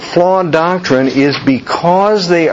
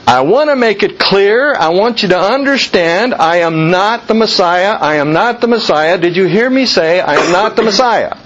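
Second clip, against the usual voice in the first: raised, insistent repetition; John pressing his denial, pitched up; emphatic insistence; 6 semitones higher than usual. Retold or imitated. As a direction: hammer home I am not the Messiah. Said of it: "an emphatic repetitive".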